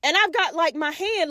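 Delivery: normal talking voice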